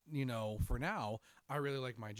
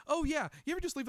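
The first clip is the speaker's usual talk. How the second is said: high, loud, rushed cover-up; backtracking defensively; 14 semitones higher, 6 dB louder, faster than usual. Retold or imitated. Retelling his backtrack on the Skittles remark, hurried defence. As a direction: scramble to defend a blunder. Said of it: "I backtracked and tried to defend it".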